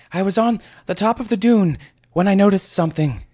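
high, loud, strained lad's voice; squeaky youth. Acted. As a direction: blurt it out like a nervous boy. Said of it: "he eventually panted".

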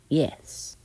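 understated contempt; drawn, disdainful agreement; scornful. A narrator's reading. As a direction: say it with a curled lip. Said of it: "curling her lip".

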